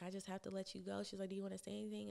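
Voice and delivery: high, soft imitation of a boss breaking bad news; imitated apologetic manager. Imitating manager gently firing her.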